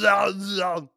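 Austrian-accented Arnold impression, loud; heavy Austrian accent, Arnold style; Schwarzenegger impression. Friends mocking Arnold, Austrian-accented loud no. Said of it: "it's just the way he talks".

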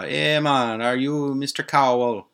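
high, lilting bad Jamaican accent; shitty Jamaican accent. Nurse checking the patient's name, badly faked Jamaican accent. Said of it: "I know it's a shitty Jamaican accent".

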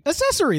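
Accessory said "uh-SESS-uh-ree", no K sound; high, defensive "a-sessory", dropping the K; disputed pronunciation. Shown as a demonstration. Defending his way of saying it, Accessory as "uh-SESS-uh-ree". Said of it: "That's what I said".

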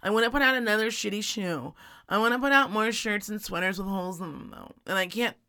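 whiny imitation of the spouse; lightly voiced self-pitying whine; mocking spouse voice. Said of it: "and you're like".

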